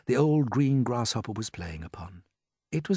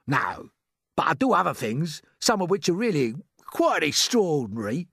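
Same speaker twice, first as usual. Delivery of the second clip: put-on earthworm voice, perking up; brightening earthworm; 4 semitones higher than usual. A narrator's reading. Earthworm telling James of his talents, brightening.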